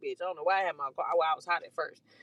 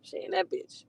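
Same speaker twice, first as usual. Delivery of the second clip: muttering to self; 8 dB quieter, slower than usual. Retold or imitated. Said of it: "So I'm like".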